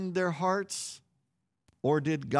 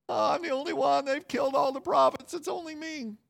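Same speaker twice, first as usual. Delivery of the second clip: higher, self-pitying whine; woe is me whine; 8 semitones higher than usual. Retold or imitated. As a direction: moan like a sorry prophet. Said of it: "his little woe is me rant".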